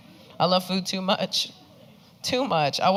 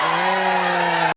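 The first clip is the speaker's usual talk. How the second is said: loud call to the crowd; a crowd-hyping yeah; 3 dB louder than usual. Real, not acted. Comic hypes Puerto Ricans in the audience, loud yeah. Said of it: "I know we got Puerto Ricans here".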